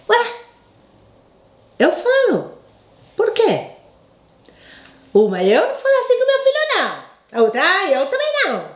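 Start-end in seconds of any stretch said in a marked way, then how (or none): 0.1-3.7 high, innocent surprised reply; mock surprise. Woman answering onlookers, high surprised why. 5.1-7.4 high fast imitating a salon woman; a quick high hint of a gossiping customer; mock onlooker. Salon customer admitting she never talks that way, high and fast. 7.6-8.6 high quick imitated customer; lightly voiced second lady, high; mock onlooker. Second customer agreeing, quick and high.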